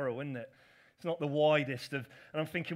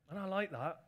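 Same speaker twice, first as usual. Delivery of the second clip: usual speaking voice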